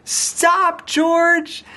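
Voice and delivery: high-pitched, urgent cry to stop; alarmed shout. A narrator's reading. The nurse as George races down the hallway, alarmed shout. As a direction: shout after a runaway in a high voice.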